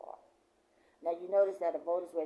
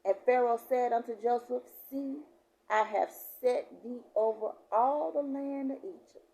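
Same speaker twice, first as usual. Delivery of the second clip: raised, emphatic preacher's reading; scripture reading; 5 semitones higher, 5 dB louder than usual. Real, not acted. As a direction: declare the verse to the congregation. Reads scripture aloud.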